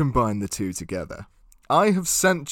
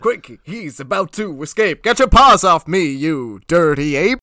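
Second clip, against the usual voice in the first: louder, raised stage voice; hammed-up musical drama; 5 dB louder than usual. Human captive defying the apes in a musical, hammed-up outrage. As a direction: proclaim it, slowing on the last words.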